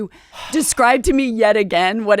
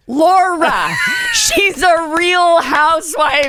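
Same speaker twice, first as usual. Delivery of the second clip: loud, higher laughing shout of a name, then the news; exasperated shout; 8 semitones higher, 7 dB louder than usual. Retold or imitated. Friend Sam finally telling Laura who the woman is, laughing shout. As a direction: spell it out at full volume through laughter.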